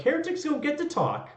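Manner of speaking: high, fierce inquisitor pronouncement; zealous inquisitor passing sentence. Acted. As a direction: condemn the heretics. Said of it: "went full actual Inquisition".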